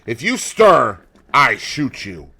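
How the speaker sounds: loud, menacing warning; threatening. Acted. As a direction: threaten him at gunpoint. Gunman threatens Dorian.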